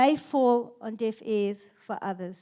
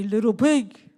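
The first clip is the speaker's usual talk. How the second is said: louder, booming storybook wolf voice from a dad; imitating a dad's gruff wolf voice, louder; dad's big gruff voice; 3 semitones lower, 8 dB louder than usual. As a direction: boom it out like a dad playing the wolf at bedtime. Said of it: "my dad in his big gruff voice would say".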